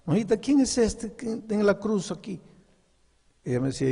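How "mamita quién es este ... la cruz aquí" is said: put-on high, childlike voice; small child asking. Little boy asking his mother about the cross, high childlike voice. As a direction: ask it like a curious little boy. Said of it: "Le preguntaba a mi mamá".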